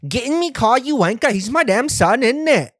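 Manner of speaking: high, loud mock British accent; mock a British bloke telling you to get in his car; loud mocking British "Get in me car, you wanker". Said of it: "talk like that".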